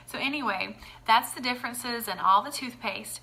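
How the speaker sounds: usual speaking voice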